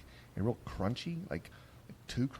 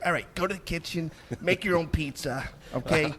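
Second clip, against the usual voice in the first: bossy bartender voice; 7 semitones higher, 4 dB louder than usual. Pretend. Imagined worse bartender.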